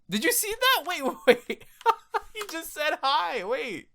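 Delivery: high and laughing through it; real giggling surprise; laughing disbelief. Real, not acted. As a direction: crack up at what you see.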